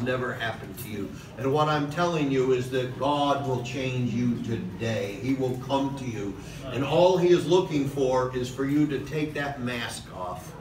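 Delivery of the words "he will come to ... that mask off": normal talking voice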